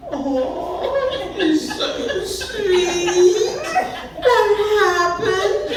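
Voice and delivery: very high, long-drawn syrupy cooing. Acted. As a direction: coo over a pitiful pet, stretching every word.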